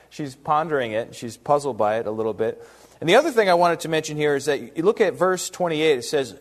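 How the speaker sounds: normal talking voice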